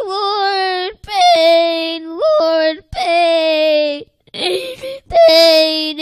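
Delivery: loud, tearful cries of pain; anguished cry. Acted. Burning drunk man crying to the Lord, anguished. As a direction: wail out in torment.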